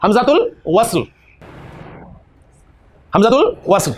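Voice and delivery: हमजातुल वस्ल repeated firmly, each syllable clear; teacher repeating the term for the class; term drill. Shown as a demonstration. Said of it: "इसका नाम है जी हमजातुल वस्ल".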